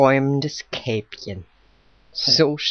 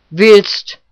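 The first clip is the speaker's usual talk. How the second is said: loud, higher one-word question; lightly voiced woodcutter, pitched up; woodcutter's offer; 6 semitones higher, 11 dB louder than usual. A narrator's reading. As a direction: fire it out abruptly, loud. Woodcutter blurts an offer to girl.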